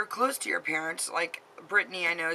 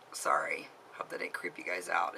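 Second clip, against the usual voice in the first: sudden breathy whisper, far softer; whispered sorry; 13 semitones lower, 6 dB quieter than usual. Real, not acted. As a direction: drop to a whisper, embarrassed. Apologizing after popping her neck.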